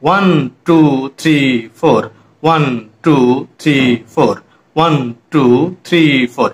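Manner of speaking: evenly spaced, drawn-out count; rhythmic counting. Shown as a demonstration. Teacher shows students how to count beats, rhythmic counting. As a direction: count out a steady beat, stretching each number. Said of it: "এইভাবে count করবি কিছুক্ষণ".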